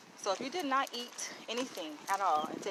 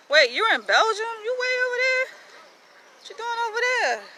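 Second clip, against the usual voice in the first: surprised; 12 semitones higher, 7 dB louder than usual. Retold or imitated. Surprised viewer questions read out.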